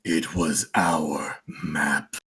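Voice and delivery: low, soft and slow undead voice; revenant voice. Acted. Revenant answers his companion about the map, low and slow.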